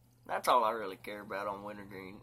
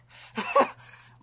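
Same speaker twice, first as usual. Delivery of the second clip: big burst of laughter; loud laugh; 14 dB louder than usual. Real, not acted. Laughs at own mistake.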